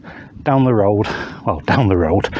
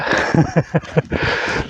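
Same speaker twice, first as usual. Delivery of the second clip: breathy self-mocking laugh; 5 semitones higher than usual. Real, not acted. Hiker admitting he is not fit, breathy chuckle. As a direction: laugh it off lightly.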